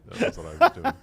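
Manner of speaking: squeaky laughing aside; laughing through the words; high laughing. Real, not acted. Laughing joke about wrestling pirate.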